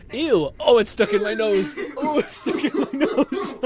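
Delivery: high, loud disgusted whine, laughing; grossed-out boyfriend. Retold or imitated. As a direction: squeal it out through laughter. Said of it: "you can tell he's like".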